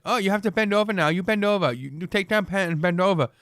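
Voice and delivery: put-on high, hurried caricature of a Chinese accent; hammed-up Chinese-accented English; mock Chinese accent. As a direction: order them about in a bad Chinese accent. Acting out the Chinese testers.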